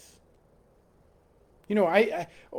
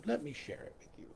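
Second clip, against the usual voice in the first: whispered, quick and breathy; mock whisper; 6 semitones lower, 19 dB quieter, faster than usual. Preacher mimics a Westerner whispering an offer of love. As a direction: whisper it like a shy confession.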